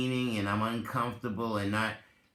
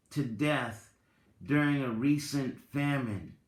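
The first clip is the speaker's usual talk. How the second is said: measured storyteller reading, lingering on key words; story narration; 3 semitones higher, 3 dB louder than usual. Acted. Reads Tao parable aloud.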